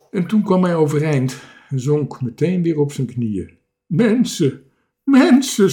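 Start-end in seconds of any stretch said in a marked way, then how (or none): 3.9-5.7 high, loud, emotional cry; sobbing. A narrator's reading. Castaway seeing people at last, sobbing. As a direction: wail it, overcome. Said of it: "snikte hij".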